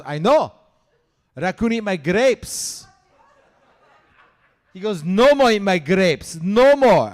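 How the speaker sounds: put-on Greek dad accent. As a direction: hammer it like a fed-up Greek father. Greek dad's broken English.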